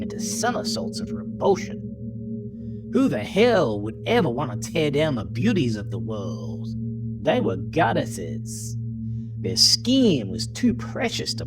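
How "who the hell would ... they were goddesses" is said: plain reading voice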